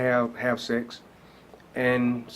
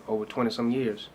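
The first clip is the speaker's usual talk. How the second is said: ordinary talk, nothing marked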